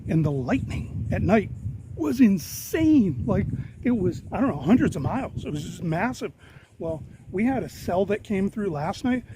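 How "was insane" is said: drawn-out amazement. Real, not acted. Raving about the lightning storm.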